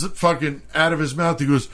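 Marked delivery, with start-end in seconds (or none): none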